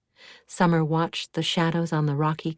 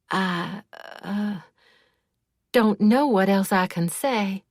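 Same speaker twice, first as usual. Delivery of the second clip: halting, nervous start then higher; stammering; 3 semitones higher than usual. A narrator's reading. Pressed friend cornered about the death, stammering. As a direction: trip over the first words, cornered. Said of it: "she stammered".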